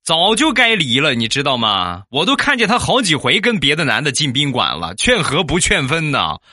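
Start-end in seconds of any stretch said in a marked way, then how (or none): 0.0-1.1 higher, louder eager outburst; eager agreement. Retold or imitated. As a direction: jump right in and agree. Eagerly badmouths friend's wife.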